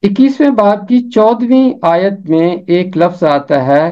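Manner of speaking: usual speaking voice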